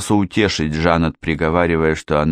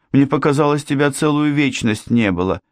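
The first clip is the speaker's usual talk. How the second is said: high-pitched worried complaint; anxious daughter; 8 semitones higher than usual. A narrator's reading. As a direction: fret at father in a raised voice. Anxious daughter to her father. (высокий встревоженный голос дочери)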